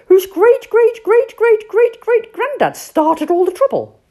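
high, loud indignant cry; outraged shout. Acted. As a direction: explode with outrage. Rat's outraged outburst.

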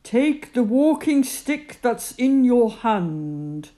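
God's voice. Acted. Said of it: "God said".

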